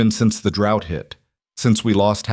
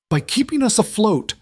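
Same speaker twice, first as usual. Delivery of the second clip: much higher, pleading protest; desperate, rising voice; 13 semitones higher than usual. A narrator's reading. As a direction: raise the pitch sharply in desperation. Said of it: "Harlan's voice rose desperate".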